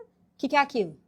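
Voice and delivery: ordinary talk, nothing marked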